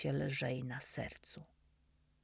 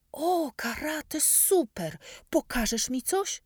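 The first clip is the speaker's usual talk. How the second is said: put-on high, loud boyish cry, slow on karate; excited shout; 10 semitones higher, 5 dB louder, slower than usual. A narrator's reading. Dominik shouts at the narrator about karate, high and loud. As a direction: burst out with delight. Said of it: "krzyknął".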